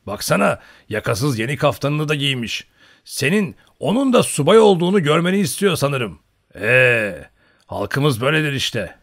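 hearty commentary on a dressed-up man; rowdy old hunter poking fun; old hunter scoffing. A narrator's reading. Old hunter mocks dressed-up landlord.

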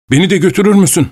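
high, eager pleading voice of a feverish man; pleading madman. Acted. Feverish madman begs the traveller, high and eager.

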